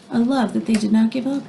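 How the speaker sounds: ordinary delivery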